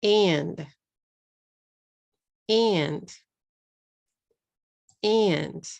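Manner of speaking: "And" said slowly and clearly as one syllable, repeated; pronunciation model for learners; slow careful demo. Shown as a demonstration. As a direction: model the word "And" with a clean single beat. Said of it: "How many syllables?".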